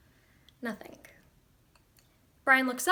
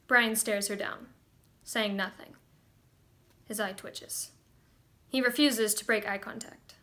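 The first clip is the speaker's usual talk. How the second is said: ordinary talk, nothing marked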